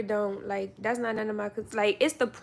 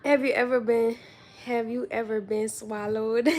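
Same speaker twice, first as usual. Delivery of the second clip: ordinary delivery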